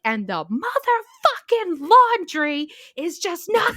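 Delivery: high, loud, drawn-out wail of frustration; losing it. Retold or imitated. Overwhelmed patient venting to a friend about laundry, losing it. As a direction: melt down over every word, slowly. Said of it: "I was just losing it".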